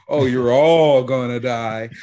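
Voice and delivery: loud, drawn-out mock proclamation; hammed-up warning breaking into a laugh; mock doom. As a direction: exaggerate the dire warning, slow and loud. Mock doom aside, louder, drawn out.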